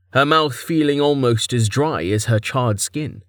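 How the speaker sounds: ordinary delivery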